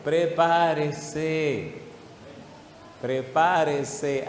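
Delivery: drawn-out, very slow warning; slow urging. Real, not acted. Preacher urging the church to get ready, very slow. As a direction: stretch every syllable as a warning.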